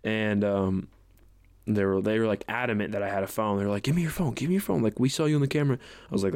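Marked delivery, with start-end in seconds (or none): 3.8-5.8 higher, insistent repeated demand; lightly voiced pushy school staff; imitating demanding staff. Staff demand the phone from the student, mock insistent.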